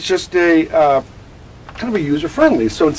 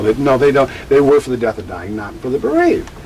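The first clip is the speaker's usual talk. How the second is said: ordinary delivery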